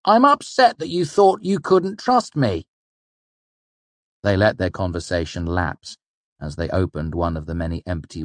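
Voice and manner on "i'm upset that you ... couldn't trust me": high, loud, indignant protest; spluttering upset. A narrator's reading. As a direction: burst out hurt and affronted, voice raised. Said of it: "Bast spluttered".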